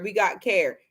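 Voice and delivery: ordinary talk, nothing marked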